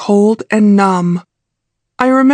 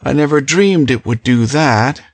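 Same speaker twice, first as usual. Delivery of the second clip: male character voice; 5 semitones lower than usual. A narrator's reading. Male character voiced much lower.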